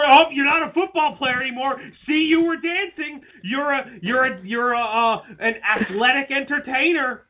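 high, mocking accusation; mock scolding. Imitating Vince judging a dancing football player, mock scolding.

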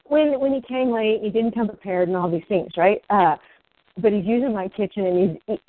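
ordinary delivery

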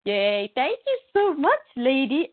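high, slow, gushing thanks; playfully overjoyed thank-you; retold delight. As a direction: draw out an acted happy cheer. Acting out her thanks on phone.

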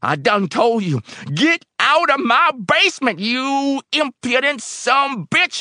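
very high, loud screamed order; enraged scream. A narrator's reading. Old man ordering helper from basement, screaming. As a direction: scream him out, stretching the curse. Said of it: "he screamed".

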